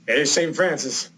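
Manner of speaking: Morgan Freeman. Retold or imitated. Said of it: "attempt to do Morgan Freeman".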